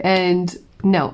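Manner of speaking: usual speaking voice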